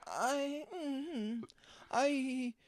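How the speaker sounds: high, halting, drawn-out oddball voice; [name]. Acted. Oddball neighbour playing the Mad Titan, high halting voice. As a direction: stretch each word out high, with long hesitations. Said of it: "are you [name] doing Thanos?".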